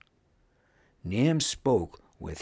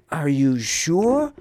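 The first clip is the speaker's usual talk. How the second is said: high, louder doubtful question; 8 semitones higher, 7 dB louder than usual. A narrator's reading. Pun questions Wuz's promise of land, doubtful. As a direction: ask it needing to be convinced. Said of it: "questioned Pun".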